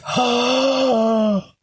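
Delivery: long, high gasped "ah"; mock shocked gasp. Reacting to John's confession, mock shocked gasp. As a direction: draw out a high, theatrical gasp.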